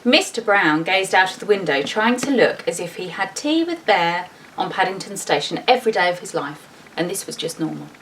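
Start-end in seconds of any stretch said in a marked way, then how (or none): none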